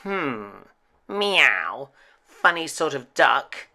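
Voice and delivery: cat sizing up the odd bird. A narrator's reading. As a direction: muse it like a doubtful cat. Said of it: "said the cat".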